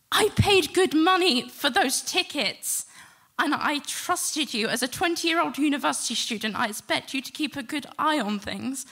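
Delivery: angry scolding. Retold or imitated. Mother scolding her son James for forgetting the tickets, angry. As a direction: hammer each word as a rebuke.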